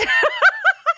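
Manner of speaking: high helpless laugh; giggling fit; real burst of laughter. Real, not acted. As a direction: crack up at the request. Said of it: "oh my God, I can't do it".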